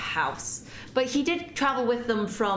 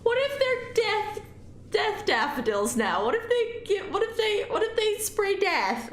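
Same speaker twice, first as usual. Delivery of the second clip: high, anxious what-ifs; comic panic; 14 semitones higher than usual. Real, not acted. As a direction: fret in a squeaky, panicky voice. Imagines the flowers being deadly.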